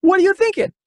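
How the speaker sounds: high-pitched, incredulous outburst; freaking out. Acted. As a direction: freak out at a baffling act, voice way up. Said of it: "Dave freaks out".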